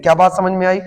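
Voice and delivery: normal talking voice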